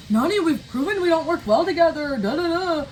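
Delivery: mock a strange accent that isn't how he talks; Kyle's odd accent. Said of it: "I don't know what that accent was".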